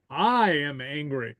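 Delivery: long, leaning stress on "I" in "I am angry"; emphatic I. Shown as a demonstration. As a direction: stretch the "I" slowly, then let "am angry" follow. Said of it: "the emphatic".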